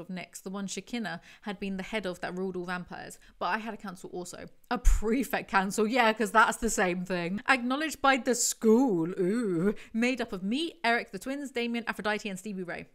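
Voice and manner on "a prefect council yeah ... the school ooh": loud mocking sarcasm; sarcastic. Real, not acted. Mocking the prefect council as a real council, loud sarcasm. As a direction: scoff at the idea, loudly.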